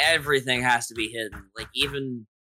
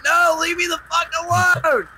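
annoyed yell; 4 dB louder than usual. Retold or imitated. Yelling at grandma, slow.

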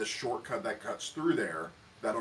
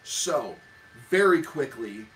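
urgent narration; 4 semitones higher, 7 dB louder than usual. A narrator's reading. GM announces the avalanche.

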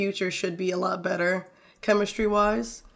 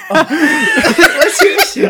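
high laughing squeal; 12 dB louder, much slower than usual. Acted. Laughing mock scolding friend.

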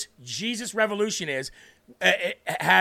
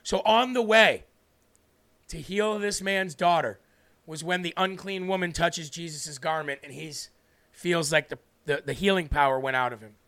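ordinary delivery

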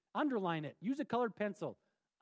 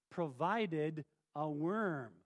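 slow emphasis; 5 semitones lower, much slower than usual. Shown as a demonstration. Stresses God provided repetition.

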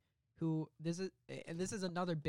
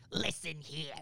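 Frank Grillo impression; slower than usual. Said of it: "Is that how he sounds?".

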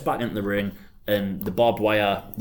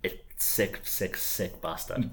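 hushed, softer muttering; muttered; 8 dB quieter, slower than usual. Real, not acted. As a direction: mutter it under your breath. Muttered aside.

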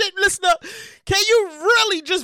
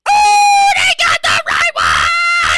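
high, loud drawn-out hollering; stretched-out high whoop of disbelief; elated whoop; 11 semitones higher, 6 dB louder, much slower than usual. Real, not acted. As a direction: holler it slowly in sheer delight. Elated reaction to windfall story.

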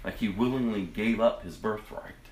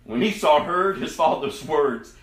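usual speaking voice

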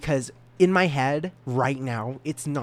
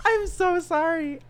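high slow fake sob; hammed-up high crying apology; mock squeaky crying; 3 dB louder, slower than usual. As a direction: sob it in a chipmunk voice.

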